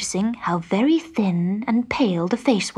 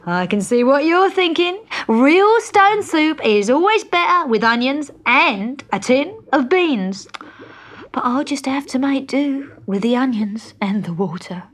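wheedling tramp; 6 semitones higher, 5 dB louder than usual. A narrator's reading.

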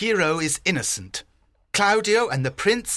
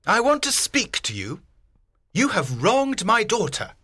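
indignant; 3 semitones higher than usual. A narrator's reading. Wronged father confronts the prince.

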